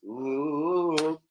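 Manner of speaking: ordinary talk, nothing marked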